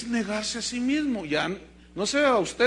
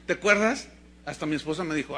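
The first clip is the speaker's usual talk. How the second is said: ordinary delivery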